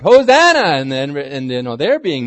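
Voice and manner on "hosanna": Hosanna shouted much higher and louder; joyful shout. Retold or imitated. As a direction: cry out Hosanna like a cheering crowd. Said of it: "the crowd's shouting out".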